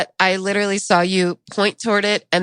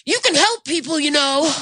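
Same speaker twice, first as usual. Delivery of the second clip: mock yelling; 8 semitones higher than usual. Stranger scolding drivers for not helping, mock yelling. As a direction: mimic a man telling off bystanders. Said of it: "started yelling at everybody else".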